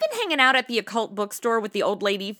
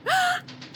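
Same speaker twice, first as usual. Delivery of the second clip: high, thrilled gasp; delighted gasp. Acted. Hears of expense money.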